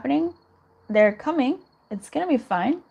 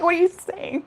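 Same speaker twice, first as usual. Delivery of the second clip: words squeezed through a giggle; laughing; 7 semitones higher than usual. Real, not acted. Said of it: "I'm not laughing at you".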